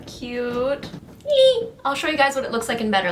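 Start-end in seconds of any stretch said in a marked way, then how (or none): 1.2-1.7 high happy squeal; excited squeal. Real, not acted. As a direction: squeal with delight. Happy squeal at finished look.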